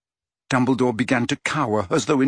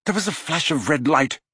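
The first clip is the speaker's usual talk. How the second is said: usual speaking voice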